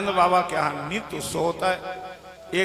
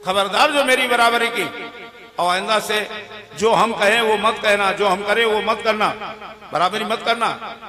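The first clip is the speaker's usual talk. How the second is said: loud, raised-pitch warning; stern scolding; 3 semitones higher, 6 dB louder than usual. Acted. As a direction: lay down the law to a daughter-in-law. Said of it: "इतना डांट करके बहू को". (ज़ोर से डाँटते, चेतावनी देते हुए)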